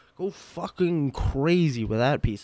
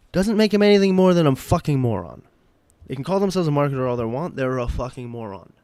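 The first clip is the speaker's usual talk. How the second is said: loud, raised-voice angry outburst; real pissed-off; 8 dB louder, faster than usual. Real, not acted. Fed-up buyer ranting about the marketers, angry and loud. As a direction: blast it out in open contempt. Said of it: "I think I'm actually pissed".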